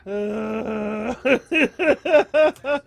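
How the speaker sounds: wheezing, crying laughter; hysterical laughter. Real, not acted. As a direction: laugh until you cry. Laughing fit.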